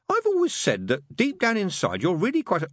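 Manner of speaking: high, gushing fondness; beaming. A narrator's reading. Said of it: "he said, beaming".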